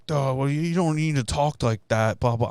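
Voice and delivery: sneering caricature of a sulky coworker; mocking dumb coworker. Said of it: "And he was just like".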